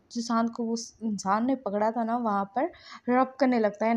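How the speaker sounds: usual speaking voice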